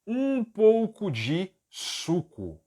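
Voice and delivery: drawn-out words, pitched high at the start; slow model phrase. Shown as a demonstration. Teacher gives students a phrase to translate, slowly. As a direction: spell the phrase out slowly for learners. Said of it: "se eu quiser dizer".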